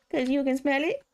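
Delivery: quoted punchline lifted at the end. Retold or imitated. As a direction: raise it like a cheeky question. Quoted punchline, higher with rise.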